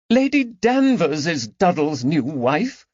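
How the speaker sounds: put-on high, genteel voice of a tired older lady; a high, fragile society lady; weary lady. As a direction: sound worn out but gracious. Lady Caroline reveals news.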